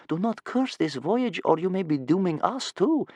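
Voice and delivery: put-on young boy's high-pitched caution; small boy pointing out. A narrator's reading. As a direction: object carefully as a little boy. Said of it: "Bear Cub pointed out".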